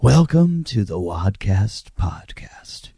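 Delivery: slow, drawn-out showman announcer; announcer intro. Pretend. Host welcoming podcast listeners to the show, hammed-up announcer. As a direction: stretch the words like a ring announcer.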